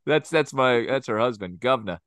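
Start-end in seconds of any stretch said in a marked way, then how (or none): none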